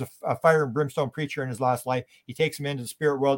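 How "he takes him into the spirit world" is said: ordinary talk, nothing marked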